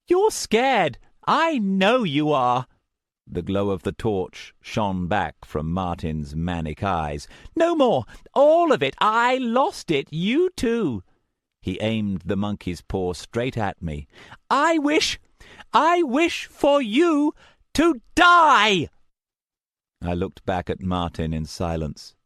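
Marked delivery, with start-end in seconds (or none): 0.1-2.7 high, loud, unhinged taunting; wild voice. A narrator's reading. Madman taunting the narrator: wild high shouting. 7.6-11.0 high, loud, unhinged taunting; wild voice. A narrator's reading. Madman taunting the narrator: wild high shouting. 14.5-18.9 high, slow, loud lunatic curse; crazed death wish. A narrator's reading. Madman wishing the narrator dead: high crazed curse.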